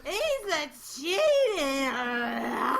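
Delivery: slow, loud, drawn-out mock yell. Friend voicing the ex through the wall, a loud mock yell. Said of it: "through the wall ... I hear".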